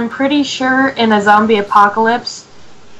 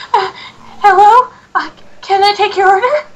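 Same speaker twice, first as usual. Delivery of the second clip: higher drive-thru worker voice; drive thru speaker; 9 semitones higher than usual. Retold or imitated. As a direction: voice the fast food clerk at the speaker. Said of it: "walk to the drive-thru speaker".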